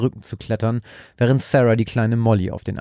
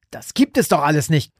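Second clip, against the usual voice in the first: desperate shriek; 6 semitones higher than usual. A narrator's reading. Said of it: "kreischte er verzweifelt".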